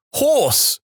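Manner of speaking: sudden high urgent cry; mock-urgent sleep-talk outburst. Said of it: "but with a sense of urgency".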